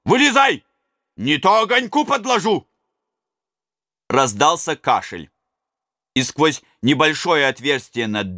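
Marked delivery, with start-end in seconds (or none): 0.0-2.7 shouting a threat at the miller to come out; angry shout. A narrator's reading. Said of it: "закричал он".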